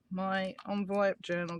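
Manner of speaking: ordinary talk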